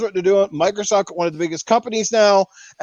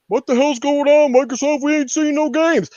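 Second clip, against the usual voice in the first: higher, whiny complaint from fed-up fans; a mock fed-up fan calling out Microsoft; impatient fans; 6 semitones higher than usual. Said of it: "we're like".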